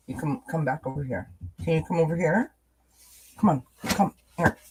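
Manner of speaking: soft, high coaxing to a pet; coaxing the dog. Real, not acted. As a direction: gently lure the dog over, hushed.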